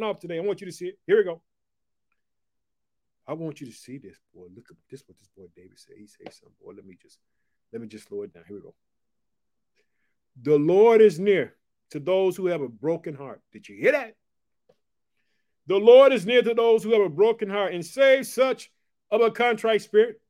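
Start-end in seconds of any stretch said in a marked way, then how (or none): 3.3-8.7 much softer, lower murmur; hushed aside. Real, not acted. Preacher quietly slowing down before the next verse, hushed. Preacher drops his voice to read. 10.4-20.2 raised, ringing scripture reading; scripture proclamation. Acted. Preacher reading the psalm to his viewers, ringing and raised.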